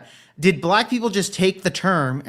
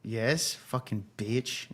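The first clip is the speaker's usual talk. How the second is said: a much softer, slow muttered joke insult; mock insult; 5 semitones lower, 15 dB quieter, much slower than usual. Jokingly cursing Kat for dropping out, muttered softly. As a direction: mutter it under your breath as a joke.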